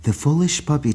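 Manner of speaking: ordinary delivery, nothing marked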